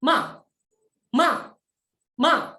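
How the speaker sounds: stamp MA out high and drop it sharply; short, emphatic falling MA; fourth tone MA. Shown as a demonstration. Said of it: "nada empat itu dihentakan".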